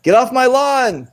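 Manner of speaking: high slow yell, stretched out; shouting at trespasser. Retold or imitated. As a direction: bark it slowly like a cranky old man. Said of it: "You know who that is?".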